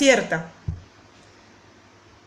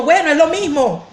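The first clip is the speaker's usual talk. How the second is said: mock annoyed retort, higher pitched; imitated annoyance; 5 semitones higher than usual. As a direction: toss it back like someone irritated.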